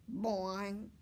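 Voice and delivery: a bouncier frog boing. A narrator's reading. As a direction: punch the frog call harder. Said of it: "He makes a funny sound".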